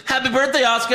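high hammed-up birthday wish; put-on high chirp; mock cheery greeting. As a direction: imitate a gushing well-wisher.

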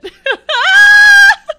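high-pitched burst of real laughter; helpless high cackle; high loud laugh. Real, not acted. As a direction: let out a loud, squealing laugh.

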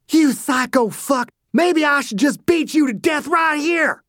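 furious shout. Acted. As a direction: rage at him. Dew raging at Dossie.

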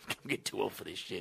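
low, hushed movie catchphrase; quiet mock actor impression; Danny Glover impression. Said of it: "your impressions of Danny Glover".

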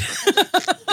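high-pitched laughing. Real, not acted. Laughing about getting cancelled.

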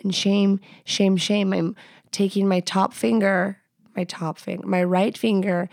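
usual speaking voice

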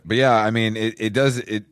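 ordinary talk

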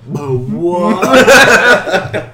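loud, high, disbelieving cry; mock shocked outburst. Reacting to Jeff Hardy's surprise win, mock shocked outburst. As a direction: exaggerate your disbelief at the result.

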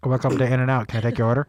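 low-pitched fast-food order taker; drive-thru worker. Pretend. Host playing drive-thru person for guest's accent test, drive-thru worker. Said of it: "I'm gonna be the drive-thru person".

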